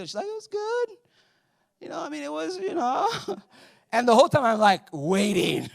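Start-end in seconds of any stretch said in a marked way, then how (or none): 0.3-3.1 imitating wife. Wife answers evasively.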